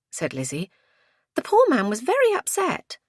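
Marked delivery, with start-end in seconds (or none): 1.4-3.0 high-pitched reproach; protesting on his behalf, voice high; reproachful. A narrator's reading. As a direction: scold gently in a high woman's voice. Woman rebukes sniggering girls.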